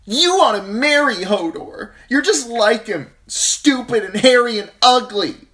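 high, loud insults; furious yelling. A narrator's reading. Sansa yelling insults at her sister, high and loud. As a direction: lash out at your sister. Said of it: "Sansa yelled".

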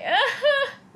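high breaking laugh through tears; half laughing, half crying. Real, not acted. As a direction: burst out in a teary laugh. Laughing while recalling crying.

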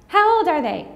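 high-voiced imitation of a nosy stranger; gushing stranger. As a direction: play the curious lady in line. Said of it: "I'm out in public again".

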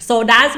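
loud, high, punched "so does"; emphatic self-correction. Real, not acted. Teacher catching her own English slip, emphatic. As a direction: hit "so does" hard, well above normal pitch.

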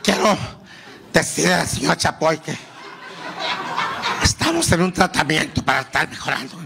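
laughing, mild shaky impression; a hint of a frail old singer. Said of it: "el [name] dijo".

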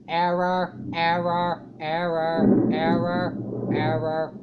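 low, drawn-out repeated alert; evenly droned, each word separate; droning error readout. Pretend. Reading a game's error messages aloud, low monotone drone.

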